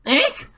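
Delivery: high loud eek of fright; sudden frightened squeal; startled shriek. Acted. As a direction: shriek in shock. Startled by shadow.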